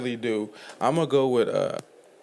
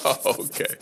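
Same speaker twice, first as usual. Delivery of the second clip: laughing. Real, not acted. Laughing on the words.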